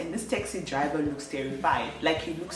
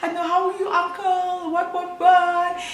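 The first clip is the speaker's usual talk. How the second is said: tearful; 12 semitones higher, 4 dB louder than usual. Retold or imitated. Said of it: "I started crying".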